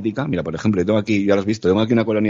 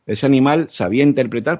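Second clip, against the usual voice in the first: ordinary talk, nothing marked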